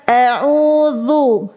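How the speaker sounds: a'udzu, tongue tip touching the upper front teeth; correct dhal. Shown as a demonstration. Teacher models the proper dhal. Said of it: "Padahal harusnya zal".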